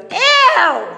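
put-on a high, loud kid's "Ew!"; a child recoiling from his veggies; disgusted little kid. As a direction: squeal it like a grossed-out four-year-old. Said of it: "And he goes".

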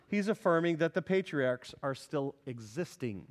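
ordinary talk

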